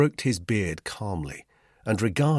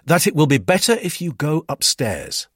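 louder, lifted, firm suggestion; 9 semitones higher, 7 dB louder than usual. A narrator's reading. Narrator orders Duclos upstairs, firmly. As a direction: send him off firmly. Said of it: "I said firmly".

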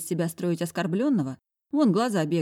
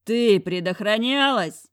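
slow, loud insistence; pressing demand; 4 semitones higher, 5 dB louder, slower than usual. A narrator's reading. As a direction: pin her down with it. Sedov presses the question. (медленный напор)

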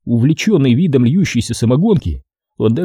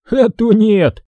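raised, indignant other-man voice; indignant Ukhov; 9 semitones higher, slower than usual. A narrator's reading. Ukhov to the narrator, taking the bottle, raised indignation. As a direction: protest as if offended. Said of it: "возмутился Ухов".

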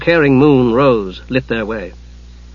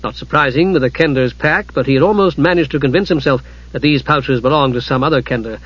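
plain reading voice

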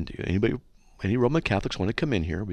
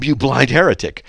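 loud insult; 5 semitones higher, 9 dB louder than usual. Pretend. Mock reply to dentist.

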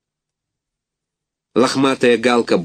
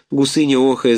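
usual speaking voice

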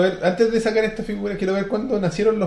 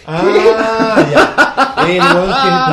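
laughing; 3 semitones higher, 10 dB louder, much slower than usual. Real, not acted. Laughing at the story.

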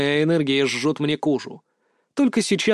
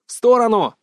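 sharp loud bark, higher; 7 semitones higher, 6 dB louder than usual. Acted. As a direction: snap out the warning loudly. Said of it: "рявкнул я Юсупову".

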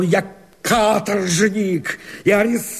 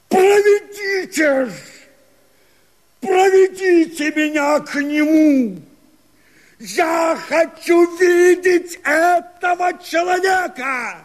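desperate shout; 6 semitones higher than usual. Acted. Begging to be led to him.

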